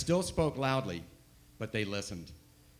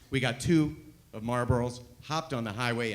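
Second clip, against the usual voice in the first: ordinary delivery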